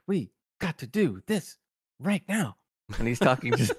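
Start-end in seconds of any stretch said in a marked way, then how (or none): 0.1-2.5 a light take on Shatner's halting Kirk delivery; lightly voiced Shatner, slow with little pauses; Shatner impression. Telling his co-host about the episode, a light Shatner impression. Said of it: "impression of William Shatner".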